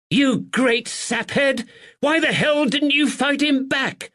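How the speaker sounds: high, loud furious yell; furious scolding. Acted. Landlady berates her husband for not fighting back, furious. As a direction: berate him sharply as a fierce wife.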